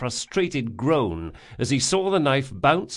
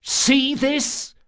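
high, pressed threat; menacing hiss; 14 semitones higher, 3 dB louder than usual. A narrator's reading. Locke holding a knife to the bandit, menacing hiss. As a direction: lean in and threaten. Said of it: "Locke hissed".

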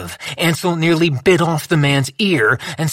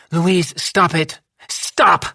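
sharp; 4 semitones higher, much slower than usual. A narrator's reading. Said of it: "she said sharply".

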